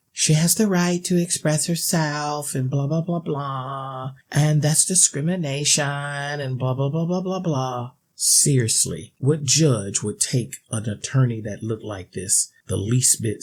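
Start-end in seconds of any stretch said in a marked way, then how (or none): 0.1-7.9 high, sneering mock of the radical critics; mocking whiny voice. Mocking the critics he calls delusional, high sneering voice. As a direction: parody the critics in a nasal-free mocking whine. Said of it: "for those of you radical, delusional idiots".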